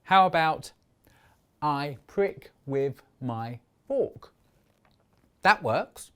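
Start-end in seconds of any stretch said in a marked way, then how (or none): 1.6-4.3 "I prick with my fork" in five slow, separate beats; slow, spaced-out "I prick with my fork"; syllable by syllable. Shown as a demonstration. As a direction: count out "I prick with my fork", a gap after each word. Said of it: "I only need five".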